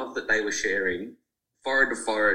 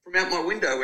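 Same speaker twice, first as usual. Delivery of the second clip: put-on high, sweet old-lady voice, much higher pitch; neighbour woman; 11 semitones higher than usual. Woman at her window greeting the old couple, in a high gushing voice. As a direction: gush at them like a neighbour who watches every day. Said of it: "this woman, like".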